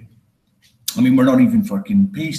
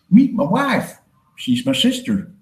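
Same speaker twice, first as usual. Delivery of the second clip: hillbilly voice; 8 semitones higher than usual. Retold or imitated. Hillbilly introducing his wife.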